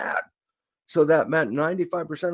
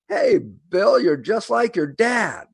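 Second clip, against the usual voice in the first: high, bright-eyed "Hey, Bill", then slowing on the verdict; excited call; 8 semitones higher, 5 dB louder, slower than usual. Retold or imitated. Telling a friend he's just like his dad, excitedly. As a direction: blurt it out like you just spotted the resemblance. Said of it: "Well, this excites you".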